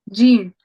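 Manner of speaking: Gene said clearly with a falling tune; Hindi letter gene. Shown as a demonstration. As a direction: model Gene for the student. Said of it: "So this is called gene".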